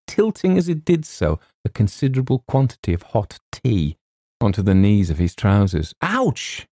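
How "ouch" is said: high, sudden yelp; startled high exclamation; pained cry. A narrator's reading. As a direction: cry out as if scalded. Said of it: "cried James, leaping".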